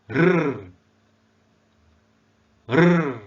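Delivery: "R" as a loud, held "rrr"; drawn-out "R", loud, swelling then falling; phonics letter sound. Shown as a demonstration. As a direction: sustain "R" as a sound, not the name "ar". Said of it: "cara pengucapan atau pronounce alfabet".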